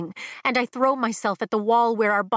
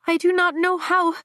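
put-on higher, hesitant young dragon; 9 semitones higher than usual. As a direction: lift up high and falter. Young dragon Andarna speaks.

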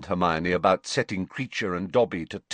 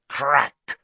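loud spoken crack, higher; sharp crack sound; 6 semitones higher, 4 dB louder than usual. Acted. Elf appears with a crack.

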